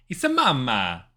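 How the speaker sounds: put-on Italian accent. Game player hamming up the tattoo word, Italian accent. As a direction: belt it out like a cartoon Italian. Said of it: "read Mama in an Italian".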